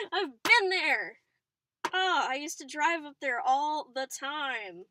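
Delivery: slow, loud, thrilled; excited. Real, not acted. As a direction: linger on it, delighted. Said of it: "I get really excited".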